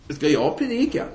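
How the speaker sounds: high-pitched retold "who, me?"; taken aback. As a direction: question it in startled disbelief. Speaker unexpectedly told to preach.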